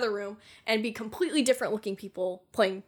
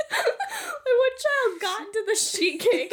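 high, laughing mock outrage of a mom; shocked mom; 14 semitones higher, 6 dB louder, slower than usual. Mom finds the cake under the mattress, laughing mock outrage. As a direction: squeal it through laughter.

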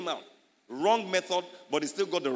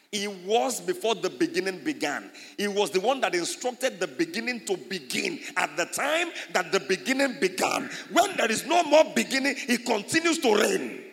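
building sermon climax; 5 semitones higher, 5 dB louder than usual. Acted. Pastor proclaims God's endless reign, building to a loud cry. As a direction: climb to a shout of praise.

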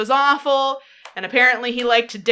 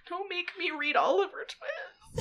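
half-laughing whimper; mock whiny plea; 7 semitones higher, 9 dB quieter than usual. Real, not acted. Pleading with friends, mock crying through laughter.

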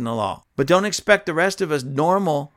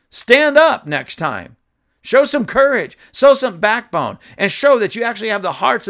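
angry scolding; 7 semitones higher, 6 dB louder than usual. Real, not acted. Host angrily demanding a politician show some backbone. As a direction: bark it at a spineless politician.

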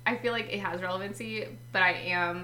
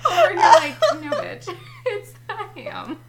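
high, loud laughing mimic; laughing mock quote; 11 semitones higher, 11 dB louder than usual. Mimicking her friend insisting it's a ham, laughing.

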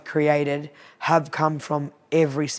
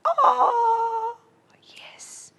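high drawn-out oh, whispered yes; squeak, then drop to a whisper; reluctant admission; 3 dB quieter than usual. Retold or imitated. Retelling customers admitting something could be better, reluctant.

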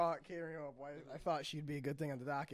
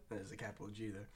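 ordinary delivery

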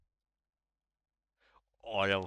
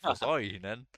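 louder, baffled question; confused; 2 semitones higher, 7 dB louder than usual. Retold or imitated. Retelling a Swede hearing Danes understand her, confused. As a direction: ask it in mock bewilderment. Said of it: "forvirret, og så var hun bare sådan".

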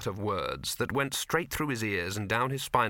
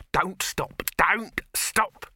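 raised, insistent command; urgent shout; 8 semitones higher, 5 dB louder, much slower than usual. A narrator's reading. Dibbler urging the handleman on, increasingly frantic. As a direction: build to a louder, higher repeat.

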